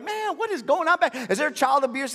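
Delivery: high, fast, incredulous moviegoers; mock outrage from the back rows; alarmed onlookers. Acting out alarmed moviegoers in theater.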